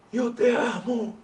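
words spoken on the in-breath; talking while inhaling. Shown as a demonstration. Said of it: "y ahí respiré, inhalé". (hablando mientras inhala)

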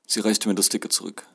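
ordinary delivery

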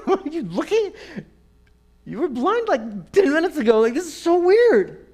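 high, loud, incredulous; amazed neighbour. Acted. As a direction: voice shooting up in loud disbelief. Neighbour amazed blind man sees.

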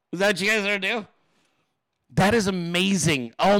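normal talking voice

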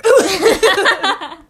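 a big, high laugh breaking out; laughing out loud at a flubbed intro; loud burst of laughter. Real, not acted. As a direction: crack up loudly at the mistake.

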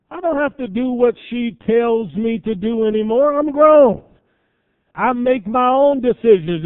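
high-pitched, emphatic grown-up declaration; defiant independence. Retold or imitated. Young adult asserting independence from his mother, defiant. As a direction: declare it boldly, voice rising high.

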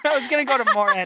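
rushed and laughing. Real, not acted. Laughing fast after the host names the college. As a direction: blurt it out fast through a laugh.

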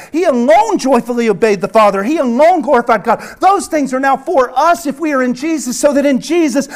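ordinary talk, nothing marked

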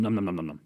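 rapid nom-nom eating noises; imitated munching. Podcaster acting out eating the cookies, comic munching. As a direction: mimic gobbling cookies in a low voice. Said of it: "I'm just eating 'em".

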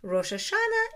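Rosh Hashanah with American vowels, high start; American accent. Shown as a demonstration. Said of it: "in American accent".

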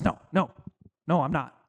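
low, offhand denial said in passing; a small, hesitant brush-off. Retold or imitated. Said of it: "It was hesitant".

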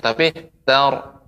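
tor with a crisp, light t before the heavy ra; clear ta. Shown as a demonstration. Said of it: "ta-nya harus tetap jelas".

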